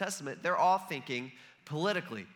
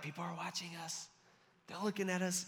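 hushed, nervous mock undertone; acted conspiratorial murmur; furtive aside; 9 dB quieter than usual. Disciples nervous about being watched.

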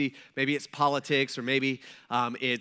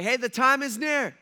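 higher, louder proclamation; mock doom announcement; 7 semitones higher, 6 dB louder than usual. False prophet announcing the end, loud mock proclamation.

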